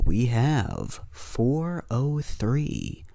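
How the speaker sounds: ordinary delivery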